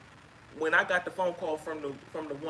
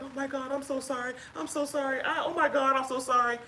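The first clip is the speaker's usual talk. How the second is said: imitating an apologetic woman; 7 semitones higher than usual. Wife imitating the caller apologizing over speakerphone. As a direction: echo her frantic sorry, pitched up. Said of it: "I'm hearing her on this phone like".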